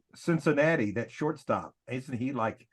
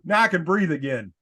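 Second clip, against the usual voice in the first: ordinary delivery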